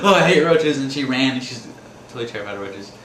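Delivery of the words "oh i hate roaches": high, loud panicked wife impression; mock fright. Wife spots a roach and bolts, mock frightened squeal. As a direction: squeal it out in exaggerated horror.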